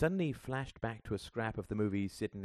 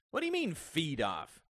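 raised-pitch, offended challenge; affronted; 10 semitones higher than usual. A narrator's reading. Father challenging his son's accusation, affronted. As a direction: throw the accusation back, stung.

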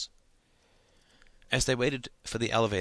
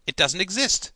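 high, raised classroom call; pupil crying out; 10 semitones higher, 6 dB louder than usual. A narrator's reading. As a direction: chime in with a cry. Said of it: "cried another".

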